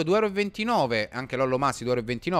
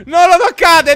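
shouting; 10 semitones higher, 13 dB louder than usual. Real, not acted. Repeated yelled plea.

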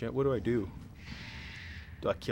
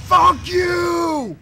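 furious shout; 9 dB louder than usual. Real, not acted. Yelling at a monster jump.

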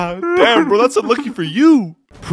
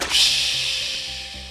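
sharp whispered shush; hushing whisper; 7 dB quieter than usual. Acted. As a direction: hush them quietly. Hushing during secret kill.

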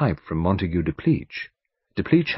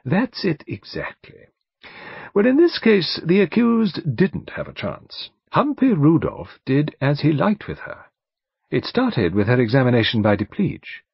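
higher-pitched, emphatic barrister; barrister, recounting trial; 6 semitones higher, 3 dB louder than usual. Acted. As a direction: recount the case with conviction.

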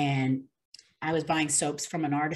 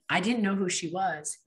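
ordinary talk, nothing marked

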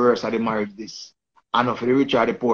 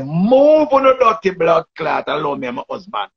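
loud, higher mock cussing rant; hammed-up angry cuss, louder and higher; imitating Queenie's outburst; 5 semitones higher, 4 dB louder than usual. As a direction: shout it like she's kicking off on live. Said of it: "she just kick out and say".

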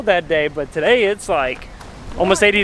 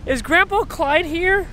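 mock surprise; 7 semitones higher, slower than usual. Dad feigning surprise for kids.

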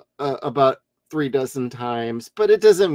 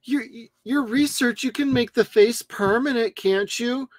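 mocking Julie; 9 semitones higher than usual. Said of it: "she was so on him about".

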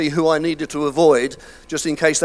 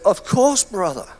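obliging reply; 4 semitones higher than usual. Retold or imitated. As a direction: re-enact an eager-to-oblige answer. Acts out his own obliging reply.

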